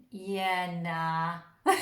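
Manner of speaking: a light New Zealand drawl on yeah, nah; Kiwi accent. Retold or imitated. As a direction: say it like a laid-back Kiwi, lightly. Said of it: "that was really Kiwi".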